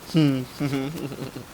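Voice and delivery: short closed-mouth chuckle; amused chuckle. Real, not acted. Laughing at own mix-up over what year it is, amused chuckle. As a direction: chuckle at your own slip.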